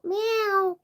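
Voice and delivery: high, drawn-out cat meow; cat meow call. Retold or imitated. Mum calling a cat's meow at [name]' door. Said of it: "call out".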